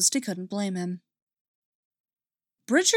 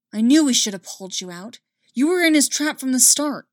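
loud, higher angry outburst; angry accusing shout; 5 semitones higher, 7 dB louder than usual. A narrator's reading. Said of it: "blame Bridger for being angry".

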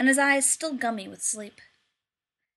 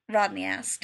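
usual speaking voice

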